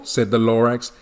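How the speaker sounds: usual speaking voice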